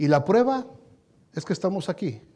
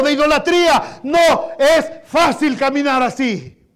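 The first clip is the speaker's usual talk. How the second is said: louder, higher preaching voice; impassioned preaching; 5 semitones higher, 6 dB louder than usual. Real, not acted. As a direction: preach it with rising fervor. Predica con fuerza. (predicación ferviente)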